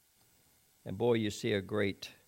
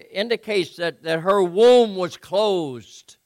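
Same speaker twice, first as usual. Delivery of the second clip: higher, louder, impassioned preaching; preaching at full force; 7 semitones higher, 11 dB louder than usual. Acted. Preacher stressing Hannah's anguish to the congregation, impassioned. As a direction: drive the suffering home. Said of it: "preacher emphasizes Hannah's suffering".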